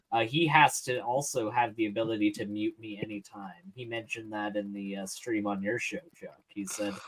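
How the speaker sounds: ordinary talk, nothing marked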